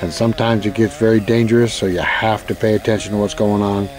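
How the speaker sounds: calm, low narration read; voiceover narration. Acted.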